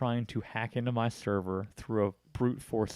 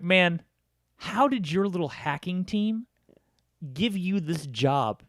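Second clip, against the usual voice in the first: slow, higher sarcastic jab; mocking taunt; 6 semitones higher, slower than usual. Taunting the scam caller, slow sarcastic jab. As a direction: drag out the mockery. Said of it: "I was like".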